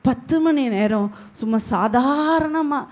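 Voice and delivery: normal talking voice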